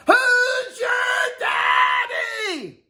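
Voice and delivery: high, slow, drawn-out victory yell; triumphant yell. Pretend. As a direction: roar it like a champion. Triumphant champion call.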